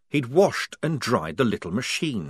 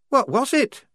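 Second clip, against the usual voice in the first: high, louder curious question; high curious asking; 4 dB louder than usual. A narrator's reading. Shufti asking Wazza, high and curious. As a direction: ask eagerly.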